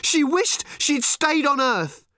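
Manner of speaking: high, loud tearful tantrum; furious teenage girl. A narrator's reading. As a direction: scream it out, close to crying. Random raging at Arthur.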